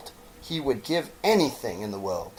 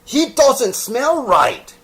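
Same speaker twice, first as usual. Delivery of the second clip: high, loud, slow alarm; alarmed exclamation; 14 semitones higher, 10 dB louder, slower than usual. Acted. Wild rabbit recoiling from the toy's smell, slow and loud. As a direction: recoil in disgust. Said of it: "he exclaimed".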